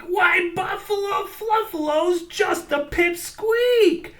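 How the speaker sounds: high mocking crow shout; grinning crow. A narrator's reading. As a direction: jeer it like a cheeky crow. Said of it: "said the crow with the grin".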